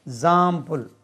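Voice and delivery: ज़ाम्पुल with weight on the zam syllable; stress on zam. Shown as a demonstration. Teacher showing where the stress falls in example. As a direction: lean hard on the Z of ज़ाम्पुल. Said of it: "stress lies on Z".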